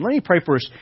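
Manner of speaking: usual speaking voice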